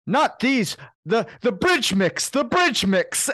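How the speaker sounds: high, loud and slow insistent demand; hammed-up fussy command; imitating Martin Short. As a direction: draw out bridge mix, high and loud. Retold Martin Short demanding nuts.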